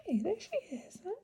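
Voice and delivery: high, hushed cooing; soothing a pet. Real, not acted. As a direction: coo softly to calm her. Cooing to the bird.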